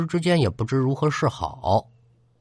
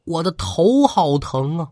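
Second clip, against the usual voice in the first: pained outcry; 6 semitones higher than usual. A narrator's reading. Younger brother clutches his head crying to elder brother in pain. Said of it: "大声的嚷嚷说".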